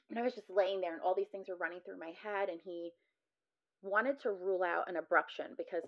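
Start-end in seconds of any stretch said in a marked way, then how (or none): none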